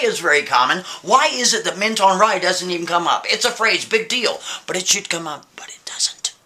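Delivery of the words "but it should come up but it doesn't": whispered, puzzled aside to himself; baffled whisper. Acted. Man baffled by an empty search, whispering. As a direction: drop into a whisper of disbelief.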